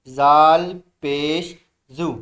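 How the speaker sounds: slow, loud drilled syllable; teacher's loud slow recitation. Shown as a demonstration. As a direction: call it out loud for the class. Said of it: "ज़ोर से बोलेंगे".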